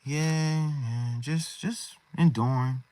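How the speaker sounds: downcast, just enduring. Acted. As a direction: answer like you are barely holding up. Acts out a downcast troubled believer.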